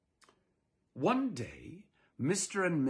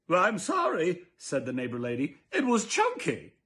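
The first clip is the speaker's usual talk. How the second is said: high, louder chirpy reply; cheery neighbor lady; 4 semitones higher, 5 dB louder than usual. Acted. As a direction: answer brightly and defensively.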